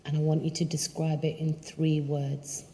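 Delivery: calm and unhurried, phrase by phrase; guided meditation. Acted. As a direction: guide them through a visualization, leaving long pauses. Leads a guided visualization.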